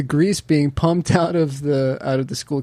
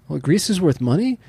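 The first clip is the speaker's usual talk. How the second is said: Homer voice; 6 semitones higher than usual. Retold or imitated. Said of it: "he's like".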